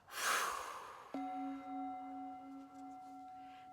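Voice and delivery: long, audible breath blown out; long exhale. Shown as a demonstration. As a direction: sweep it all out on one long breath. Sweep it out.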